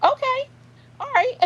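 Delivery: high-pitched, caught-off-guard stammer; a squeaky, flustered "oh... okay, all right"; flustered squeak. Retold or imitated. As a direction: retell your own startled reply way up high. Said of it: "I said".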